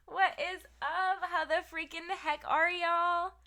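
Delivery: loud, high streamer hello; hyped greeting. Real, not acted. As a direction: greet the chat with big energy.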